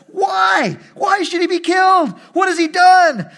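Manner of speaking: high, loud pleading cry; desperate shout. Acted. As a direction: shout your protest at your father. Son protests father's death sentence.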